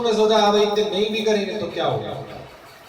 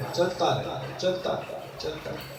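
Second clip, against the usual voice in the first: softer with each repeat, ending almost whispered; fading repetition; 5 semitones lower, 9 dB quieter than usual. Shown as a demonstration. Preacher showing the endless cycle, fading to a whisper. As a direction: let the wheel roll away into silence.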